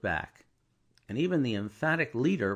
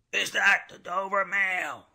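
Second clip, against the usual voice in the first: voice from the mist; 9 semitones higher, 4 dB louder than usual. A narrator's reading. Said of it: "a man's voice called from the mist".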